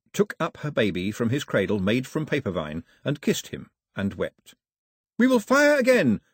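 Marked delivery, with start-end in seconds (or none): 5.2-6.2 high, loud yell. A narrator's reading. Pilou threatens the raiders in the confusion, loud high yell. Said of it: "Pilou yelled in the confusion".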